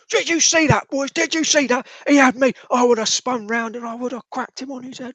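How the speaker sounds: high, loud whiny protest; indignant young constable. Acted. Constable grumbling to his mates as he leaves, high and loud. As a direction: complain loudly to his mates while leaving. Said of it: "like, goes".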